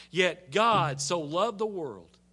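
ordinary talk

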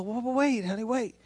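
acted soft little plea; gentle interruption. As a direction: softly halt her as if getting over tears. Retells interrupting her after crying.

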